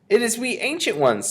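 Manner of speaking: high, thin-pitched timid witch; humble, squeaky underling; meek voice. A narrator's reading. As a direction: speak up meekly in a very high voice. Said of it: "said a meek voice from behind the door".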